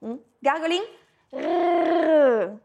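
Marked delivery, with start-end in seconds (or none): 1.3-2.6 French R, vibrating at the back of the tongue; gargled French R. Shown as a demonstration. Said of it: "you imagine you gargle".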